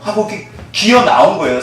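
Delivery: usual speaking voice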